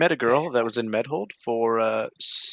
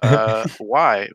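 loud acted challenge; demanding; 7 dB louder than usual. Coach asking the quitting runner for a reason, demanding. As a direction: press the quitter with a louder question.

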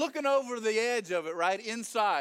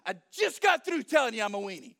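fast, loud protest; exasperated retort; 5 dB louder, faster than usual. Acted. Gideon retorting to an angel who won't listen, fast exasperation. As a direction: rattle it back, fed up.

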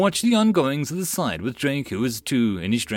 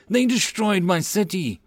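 indignant; 8 semitones higher than usual. A narrator's reading. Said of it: "felt a surge of indignation".

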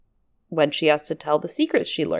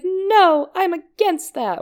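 high protest; 13 semitones higher, 4 dB louder, slower than usual. Retold or imitated. Sam protesting the dancers.

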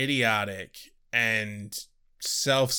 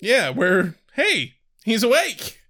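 loud, high, slow hey he's awake; excited; 8 semitones higher, 7 dB louder, slower than usual. Acted. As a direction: call it out gleefully. Spike waking mid-surgery.

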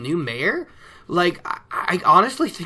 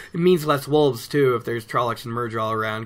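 usual speaking voice

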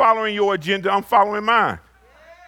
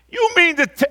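incredulous; 10 semitones higher than usual. Acted. Preacher's incredulous exclamation.

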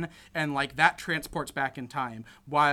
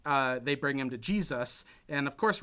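normal talking voice

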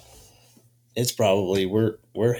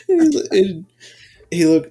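ordinary delivery

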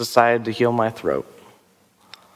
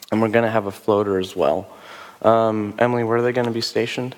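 normal talking voice

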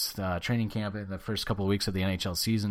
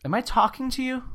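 snippy retort. Real, not acted. Snapping back at co-host.

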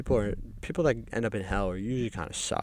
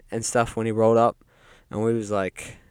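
ordinary talk

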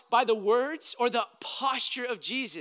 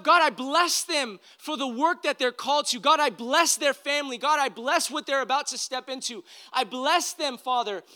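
higher, louder fervent blessing prayer over the disciples; Jesus blessing prayer; 6 semitones higher, 6 dB louder than usual. Acted. Jesus blessing his disciples before ascending, fervent raised prayer. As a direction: pray it out over them, arms raised. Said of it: "Hands lifted up".

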